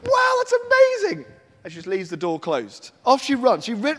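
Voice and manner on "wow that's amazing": high, loud squeal of delight; overjoyed. Acted. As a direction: burst out with sheer joy. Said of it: "she was so overjoyed".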